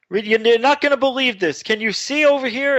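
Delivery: high, excited imitation of yelling parents; retold phone yelling. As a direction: raise the pitch for a mock yell, volume held back. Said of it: "my mom and dad are on the phone yelling".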